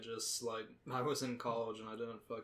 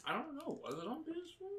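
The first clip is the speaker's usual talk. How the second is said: confused; 13 semitones higher, 5 dB quieter, faster than usual. Real, not acted. Puzzled high question.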